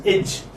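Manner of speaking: carefully pronounced "edge" with a final j sound; model pronunciation. Shown as a demonstration. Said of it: "This is how you pronounce".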